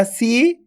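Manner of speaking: सी held long on a rising tone; सी, long and sustained; drawn-out long vowel. Shown as a demonstration. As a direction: stretch the ee of सी upward. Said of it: "खींच के पढ़ा जाता है".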